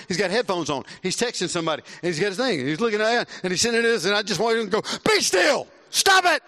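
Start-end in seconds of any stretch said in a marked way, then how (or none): 5.1-6.4 loud, high snap at a fidgeting son; exasperated yell. Retold or imitated. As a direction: bark it at the boy to stop moving. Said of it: "Like that".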